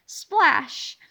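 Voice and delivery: plain reading voice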